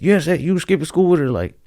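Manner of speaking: high-pitched imitation of an accusing mother; mock interrogation. Girl's mom grilling a boy by phone, mock interrogation.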